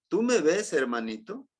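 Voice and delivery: normal talking voice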